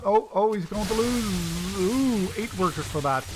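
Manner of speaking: rising thrill at a big play; excited play-by-play. Real, not acted. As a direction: react live as the damage lands. Caster reacts to big worker loss.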